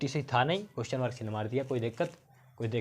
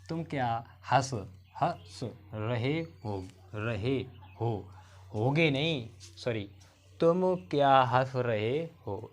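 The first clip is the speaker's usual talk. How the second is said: each word drawn out slowly for copying; very slow dictation; much slower than usual. Shown as a demonstration. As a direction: spell it out slowly so students can write. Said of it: "teacher dictates sentence slowly".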